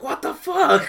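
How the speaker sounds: shocked exec outburst, high and loud; mock Sony exec. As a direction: play a stunned studio boss.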